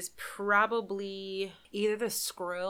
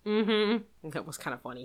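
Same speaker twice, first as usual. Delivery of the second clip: ordinary talk, nothing marked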